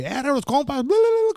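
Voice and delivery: very high, loud yell into babbled bla bla; celebratory yell. Retold or imitated. The first time he yelled his catchphrase, celebrating. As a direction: shout it like you just won. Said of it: "como celebrando".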